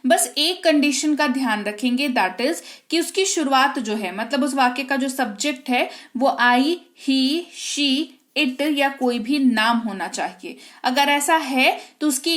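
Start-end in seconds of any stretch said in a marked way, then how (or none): none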